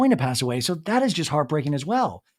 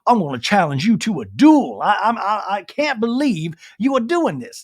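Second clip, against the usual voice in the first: put-on Southern accent, affronted and challenging to a duel; mock Southern gentleman; 4 semitones higher, 3 dB louder than usual. Host mocking Whitney's Southern mentality, hammed-up indignant Southern accent. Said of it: "you really see the Southern mentality come out".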